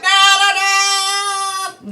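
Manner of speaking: high, loud, drawn-out wail; panicked gnome. Acted. Gnome spread out like a starfish against the plant, long high wail. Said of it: "and is like".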